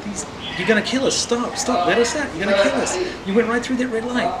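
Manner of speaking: high, frantic pleading to stop; mock-terrified high voice; freaking-out British family. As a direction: passengers begging the driver to stop. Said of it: "the British family really freak out".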